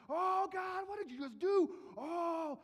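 very high, loud astonished whoop; stunned cheer. Retold or imitated. Angels watching Haman's humiliation, very high loud cheering. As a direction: cheer in disbelief at the burn.

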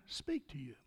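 hushed, breathy question; whisper. Real, not acted. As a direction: lean in and whisper it. Preacher drops to whisper.

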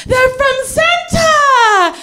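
put-on high, slow excited child; excited kid. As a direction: squeal it like a thrilled six-year-old. Excited little sister.

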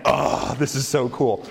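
laughing awe; giddy fan. Retold or imitated. Fanboy meeting his hero, gushing with a laugh. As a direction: gush through a laugh. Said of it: "I was just like".

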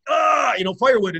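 loud, excited burst. Retold or imitated. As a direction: shout out the smashing. Describing smashing basses on stage.

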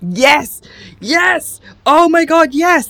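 high, loud, emphatic outburst; excited agreement. Real, not acted. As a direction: agree wholeheartedly, voice leaping up. Emphatic agreement with friend's complaint.

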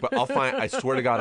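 very high, loud eager promise. Retold or imitated. Casting director promising to find him a role, high and eager. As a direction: promise it big and bright.

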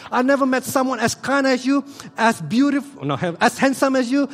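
high, loud, gushing praise; adoring wife. Retold or imitated. Wife Alice praising her husband Edric: high, loud, gushing. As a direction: gush over your husband. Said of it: "If Alice approached Edric and say".

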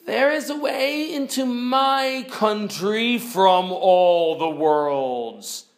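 slow, grand pronouncement falling from high pitch; loud, stretched-out majestic declaration; majestic lamb. Acted. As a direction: proclaim it slowly, drawing out the last words. Lamb proclaiming as it becomes Aslan.

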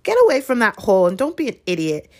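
mild angry burst at a friend; exploding in anger. A narrator's reading. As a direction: snap it out fast in exasperation. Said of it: "Ron exploded".

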